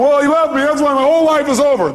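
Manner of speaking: high, loud-edged mock wail; angry, upset woman. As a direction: imitate an upset caller's high-pitched complaint. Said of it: "the reason she's this angry".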